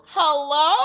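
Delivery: loud, high hello; punchy excited hello. Real, not acted. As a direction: sell it like a big reveal. Chanel PR reveal.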